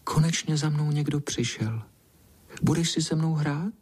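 high, timid little cat voice; high, velvety, bashful voice; soft, shy voice. A narrator's reading. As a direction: speak shyly in a soft small voice. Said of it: "hebkým hláskem plaše povídá".

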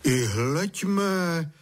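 high-pitched, wondering exclamation; surprised. A narrator's reading. Father sees Honzík back at the door, surprised. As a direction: marvel aloud, pitch lifted. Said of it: "divil se tatík".

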